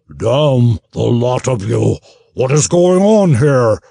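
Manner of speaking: furious gnoll captain roaring at the brawlers. Acted. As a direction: roar like an enraged gnoll captain. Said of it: "the gnoll roared".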